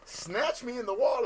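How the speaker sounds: high, loud, laughing protest; laughing indignation. Real, not acted. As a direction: exclaim it loudly through real laughter. Indignant explanation amid shared laughter.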